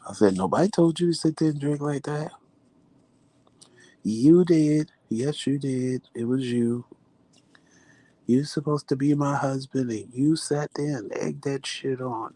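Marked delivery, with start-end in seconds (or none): 0.4-2.3 high-pitched protest with rising end; retold indignant retort. Wife argues back in retold quarrel. 4.0-12.3 mock accusing husband. Imitates husband's accusing comeback.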